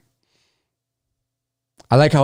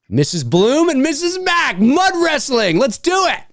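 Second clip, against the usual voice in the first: excited high; 3 dB louder than usual. Real, not acted. Excitedly proposing a mud fight.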